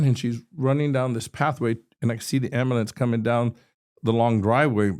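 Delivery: ordinary delivery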